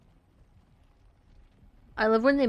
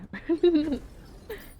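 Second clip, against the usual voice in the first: high-pitched laughing; 9 semitones higher than usual. Real, not acted. Laughing after the match.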